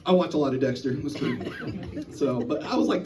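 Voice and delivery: normal talking voice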